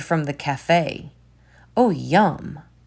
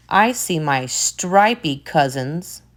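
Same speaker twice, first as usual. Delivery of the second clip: usual speaking voice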